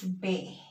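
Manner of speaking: B named "beh"; Spanish B. Shown as a demonstration. Teacher explaining B and V sound alike, Spanish B. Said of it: "It's gonna be pronounced".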